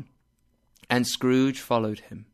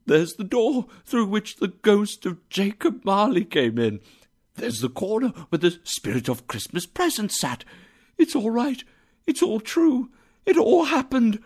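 high, breathless delight; giddy joy; 9 semitones higher than usual. A narrator's reading. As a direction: marvel with rising glee. Scrooge overjoyed in his room.

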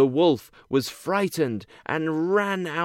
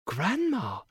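put-on higher, soft little girl; 7 semitones higher, 4 dB quieter than usual. A narrator's reading. Girl's voice.

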